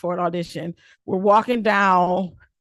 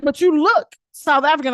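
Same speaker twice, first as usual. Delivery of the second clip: high, loud stubborn stranger voice; imitating stranger; 7 semitones higher, 8 dB louder than usual. Stranger abroad insists she looks South African, high and stubborn. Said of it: "he was like".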